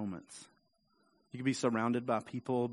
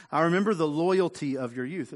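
voicing God, raised; 5 semitones higher, 7 dB louder than usual.

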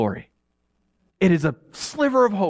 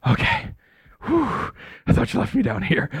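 put-on relieved child; 6 semitones higher than usual. Kids relieved finding dad.

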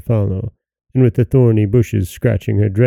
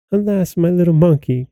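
high, aching lament over the lost monkey; grieving cry; 7 semitones higher than usual. Acted. Princess mourning her dead monkey, a high grieving cry. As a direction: let the grief lift the voice high. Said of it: "cried the princess".

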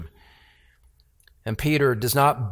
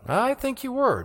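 much higher, sing-song insistence; insistent servant girl; 12 semitones higher than usual. Retold or imitated. Servant girl pressing Peter again, high needling voice.

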